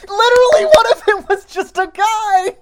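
high, loud disbelieving outburst; incredulous shout. Real, not acted. Incredulous outburst quoting movie line.

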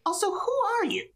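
squeaky demand, sped up; Kick voice. Acted. Kick demands stranger's name.

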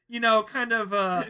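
usual speaking voice

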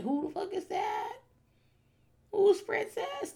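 high, soft, slow bewildered granny; a senile old lady asking who that is; confused old woman. Pretend. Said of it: "I'll be like".

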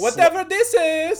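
put-on very high, loud vaguely Slavic accent; Andy Kaufman foreign accent. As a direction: blurt it in a silly foreign accent. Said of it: "non-offensive foreign accent".

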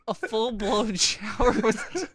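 slow, high, laughing words; cracking up, barely getting it out. Real, not acted. Restart while cracking up.

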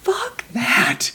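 hissed under the breath; whispered aside, fed up; whispered curse. Real, not acted. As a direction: spit it out in a whisper. Aside after retold doubt.

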